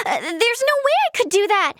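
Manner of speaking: high, gasping protest; gasp, then protest in a fluster; flustered refusal. Acted. Turning down a friend's offer, flustered.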